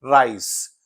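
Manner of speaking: राइस में शुरू का आर साफ़ बोलें; राइस, आर को पूरा उच्चारित करें; British rice. Shown as a demonstration. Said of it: "इसीलिए इसको वो प्रोनाउंस करेंगे". (राइस में आर स्पष्ट)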